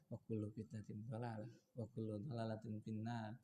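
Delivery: very quiet, low-pitched Arabic warning; hushed, deep, unemphatic delivery; low, soft recitation. Shown as a demonstration. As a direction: recite the warning with all the force taken out. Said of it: "Nggak ada seperti itu".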